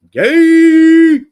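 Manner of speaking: ordinary talk, nothing marked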